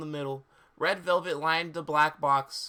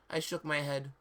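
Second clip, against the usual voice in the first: ordinary talk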